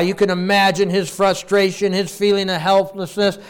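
ordinary delivery

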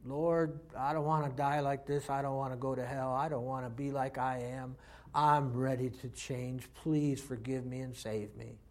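soft, hushed plea from the heart; pleading prayer. Retold or imitated. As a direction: pray it quietly, begging for mercy. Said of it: "crying out from the depths of my heart".

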